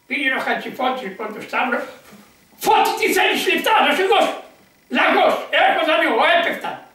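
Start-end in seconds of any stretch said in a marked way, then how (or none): 2.6-4.4 raised, eager offer called up high; mock call-out. An unbeliever calls to Fotis to buy him off, calling out. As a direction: call out to him, pushing the money.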